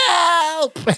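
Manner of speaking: hammed-up panicked yelp, held back in volume; mock screaming. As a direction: Balki mock-screaming help. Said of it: "Balki just starts screaming".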